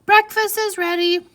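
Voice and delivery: high, loud call downstairs. Shown as a demonstration. Jasmine calling from downstairs to her mother, loud and high. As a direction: call up to mom that breakfast is ready. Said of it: "You notice how my voice changes when things have quotations".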